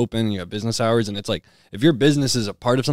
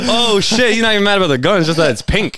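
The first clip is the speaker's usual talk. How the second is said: very high, loud mock disbelief; shocked kids; 11 semitones higher, 4 dB louder than usual. Kids realising the farmer hates that the gun is pink, in high, loud mock shock. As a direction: squeal it in stunned disbelief. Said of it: "We all just sit there like".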